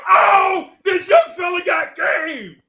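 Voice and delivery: very high, loud, slow swoon; high swooning shout. Retold or imitated. Lisa won over, shouting very high and slow. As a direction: gush like she is won over. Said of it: "She was like".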